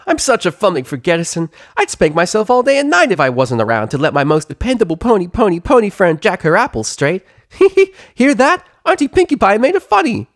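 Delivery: very high and loud, giggling; bubbly self-amused squeal; giddy pony gushing. Acted. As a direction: crack yourself up. Pinkie Pie voice.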